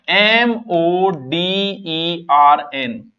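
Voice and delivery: letters spelled out slowly one by one; slow spelling. Shown as a demonstration. Teacher spells the word for students, slowly. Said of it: "spelling it out".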